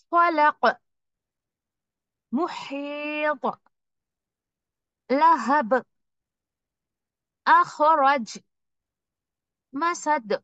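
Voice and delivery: each word stopped with a clear echoing bounce; strong qalqala. Shown as a demonstration. Teacher demonstrating strong qalqala on final letters, clear bounce. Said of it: "थोड़ा ज्यादा वाज़े करके कलकला दे रहे हैं".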